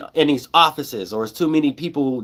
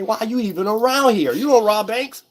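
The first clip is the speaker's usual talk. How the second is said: mocking the bank robbers; 8 semitones higher than usual. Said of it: "they gonna say".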